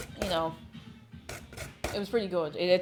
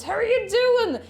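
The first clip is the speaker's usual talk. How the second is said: put-on voice; 11 semitones higher, 6 dB louder than usual. Greeting chat in a put-on voice.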